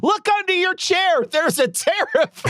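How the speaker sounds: high, hammed-up prize announcement; mock giveaway host. Host handing audience tariffs like prizes, high mock excitement. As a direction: call it out high, stretching the last phrase.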